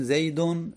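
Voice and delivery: زیدون ending in "-un", zeydun; tanwin ending. Shown as a demonstration. Teacher showing a declinable noun, زیدون ending "-un".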